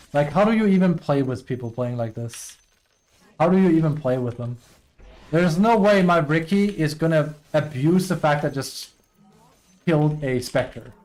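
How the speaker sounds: heated, exasperated complaint; mad. Real, not acted. Player venting about a teammate, mad. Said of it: "I'm sorry, I'm mad right now".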